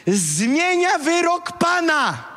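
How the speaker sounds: slow, high, shouted proclamation; preaching shout. Real, not acted. Preacher to his congregation, on prayer changing God's verdict: slow high shout. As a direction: drag each word out and cry it to the hall.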